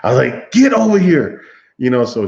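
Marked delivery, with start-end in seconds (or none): 0.5-1.4 high, loud call across the yard; calling the dog. Retold or imitated. Owner calls his dog back over, loud and high. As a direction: summon the dog sharply.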